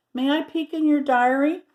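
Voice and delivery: high, sweet polite asking; a touch of polite begging; polite request. Acted. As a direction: ask as nicely as you can. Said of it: "asked George politely".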